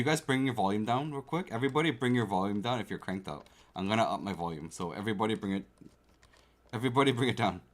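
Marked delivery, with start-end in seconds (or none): none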